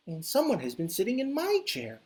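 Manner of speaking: a middling motherly bear voice; medium Mama Bear. Acted. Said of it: "in her medium-sized Mama Bear voice".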